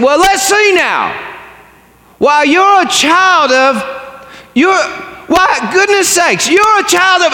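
slow, high, loud drawn-out proclamation; really loud. Acted. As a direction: stretch it out for everyone to hear. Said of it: "he said really loud".

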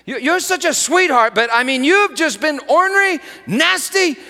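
loud, high scolding father; scolding shout. Retold or imitated. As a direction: shout at the ornery daughter. Father scolding child, retold.

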